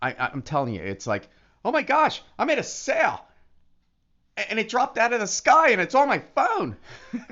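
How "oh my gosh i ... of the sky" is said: high, thrilled exclamation; gleeful high-pitched cry of disbelief; excited squeal. Retold or imitated. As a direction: squeal it like you just got paid. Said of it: "I was so excited".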